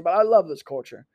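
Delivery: ordinary delivery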